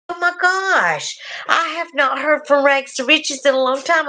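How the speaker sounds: high, delighted oh my gosh; excited. Real, not acted. Delighted to see an old viewer.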